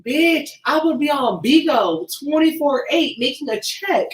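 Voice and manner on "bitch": usual speaking voice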